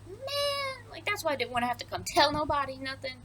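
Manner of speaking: high, whiny mock complaint; grumbling. Guard bemoans bringing news to the king, mock whining.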